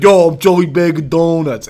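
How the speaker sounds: slow, loud mock Italian-American; Italian-American accent. Shown as a demonstration. Podcaster showing anyone can sound American, slow Italian-American accent. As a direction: drag out a brash Italian-American goombah line. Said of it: "be from Mexico and be like".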